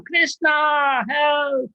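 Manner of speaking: high, desperate call; cry for help. Retold or imitated. Flooded villagers call Krishna for help, high and desperate. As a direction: call out in panic for rescue.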